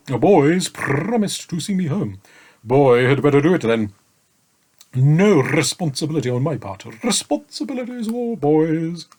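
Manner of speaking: sleepy, rambling dragon; drowsy dragon voice. A narrator's reading. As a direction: murmur like a contented dragon dozing off. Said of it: "he murmured".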